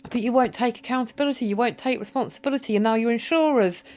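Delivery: ordinary delivery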